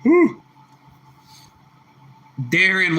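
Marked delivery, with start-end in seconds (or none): 0.0-0.4 high whew. Real, not acted. Repeated exclamation.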